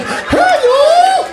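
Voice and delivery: put-on very high ventriloquist squeal with a southern accent; squeaky southern puppet voice. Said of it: "It talks in a southern accent".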